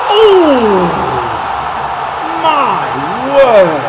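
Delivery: loud, high burst of surprise; excited shout. Real, not acted. Player reacting to a chance, excited shout. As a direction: yell out at the shot. Goal chance in football game.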